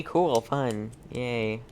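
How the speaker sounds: ordinary talk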